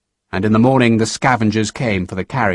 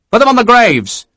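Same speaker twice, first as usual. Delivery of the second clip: higher, louder barked command; Sharp giving order; 11 semitones higher, 5 dB louder than usual. A narrator's reading. Officer commands the burial party, loud. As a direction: order them firmly to the graves.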